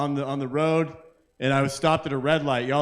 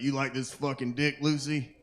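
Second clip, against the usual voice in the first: mock bedroom murmur; 7 dB quieter than usual. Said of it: "he's like".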